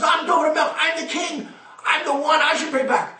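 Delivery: high, shouted kingly claim; a king shouting to be chosen; royal boast. Acted. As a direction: declare it like a king demanding his turn. King David claims the right.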